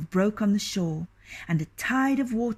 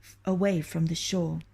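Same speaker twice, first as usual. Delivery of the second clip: plain reading voice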